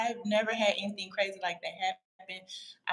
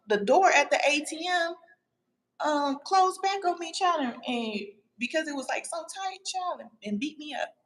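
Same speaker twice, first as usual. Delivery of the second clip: indignant retelling; 7 semitones higher than usual. Real, not acted. Retelling ATM door injury.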